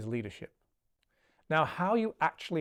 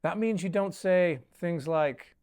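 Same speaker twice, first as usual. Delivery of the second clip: ordinary talk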